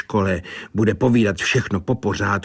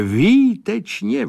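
delighted call; 13 semitones higher, 4 dB louder, slower than usual. A narrator's reading. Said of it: "volal Sládek".

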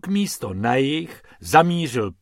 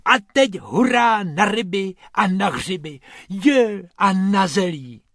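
high-pitched gleeful cheering; delighted, raised-pitch exclaiming; jubilant; 7 semitones higher, 3 dB louder than usual. A narrator's reading. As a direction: cheer the trip on. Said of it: "jásal Bobek".

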